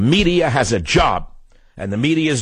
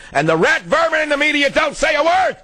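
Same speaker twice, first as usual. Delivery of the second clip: much higher, yelled outburst; shout. Real, not acted. Host raging at the media on air, shouting. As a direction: explode at the media. Rant peaks against the media.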